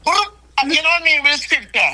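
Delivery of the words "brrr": imitated gun burst. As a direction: mimic a blazing gun. Imitated gunfire sound.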